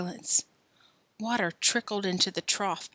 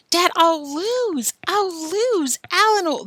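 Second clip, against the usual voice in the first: high, slow whining plea; pleading boy; 11 semitones higher, 5 dB louder, slower than usual. Acted. Billy begging his dad, high and whiny. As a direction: beg not to lose.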